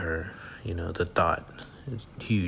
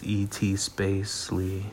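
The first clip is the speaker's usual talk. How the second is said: spelling slowly; slower than usual. Shown as a demonstration. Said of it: "spelling out handle".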